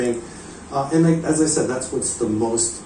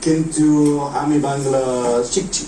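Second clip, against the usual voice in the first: careful Bengali with an American accent; foreign learner Bengali; faster than usual. Real, not acted. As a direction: speak Bangla like a beginner still learning. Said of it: "Bengali words did you learn?".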